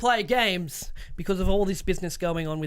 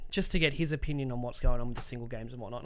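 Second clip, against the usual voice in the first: ordinary delivery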